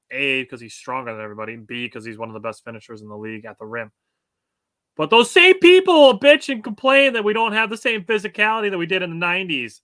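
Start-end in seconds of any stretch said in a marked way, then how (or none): 5.0-9.8 loud, high-pitched exasperated rant; fired-up sports rant. Real, not acted. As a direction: rail against the hypocrites, voice climbing. Ranting about hypocritical critics.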